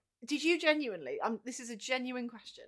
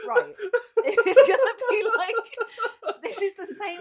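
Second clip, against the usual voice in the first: laughing through the words, much higher; 12 semitones higher, 14 dB louder, much slower than usual. Real, not acted. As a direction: talk while cracking up at the mic setup. Laughing through a mic joke.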